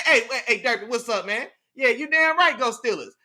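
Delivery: normal talking voice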